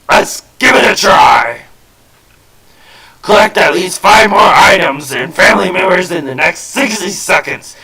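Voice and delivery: high, loud barking drill sergeant; general's voice. Acted. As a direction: shout orders like an army general. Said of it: "doing the general's voice".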